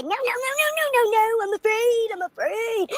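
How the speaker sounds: high, whiny mock of a scared man; mocking high whine. Mocking the host who refused her, in a high whine. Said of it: "that pussy said".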